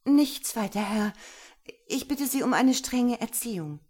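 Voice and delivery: breathless plea. Acted. Lena begs Gero for strict training, high and urgent. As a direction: blurt it out wide-eyed.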